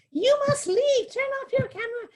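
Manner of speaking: shrill-free high British granny scolding a tourist; fussy old British guard. Retold or imitated. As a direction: squeal it like a riled-up tiny old guard. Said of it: "in a really heavy British accent".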